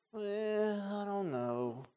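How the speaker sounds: soft, drawn-out wavering; mock reluctance. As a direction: stall doubtfully, imitating someone undecided. Imitates blind man hesitating over healing.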